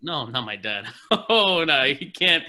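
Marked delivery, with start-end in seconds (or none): 1.1-2.4 bursting out laughing, louder and higher; loud amused laugh. Real, not acted. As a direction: laugh hard at the misunderstanding. Correcting the dad mix-up.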